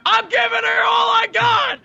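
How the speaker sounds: high, loud yell back; mock strained shout. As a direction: holler it in comic defiance.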